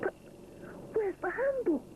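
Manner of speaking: high, puzzled exclamation; surprised not to find it; exclaiming. A narrator's reading. Rupert at the oven door, surprised exclaim. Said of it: "he exclaims".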